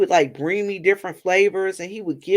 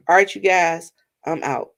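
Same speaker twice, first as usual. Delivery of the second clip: ordinary talk, nothing marked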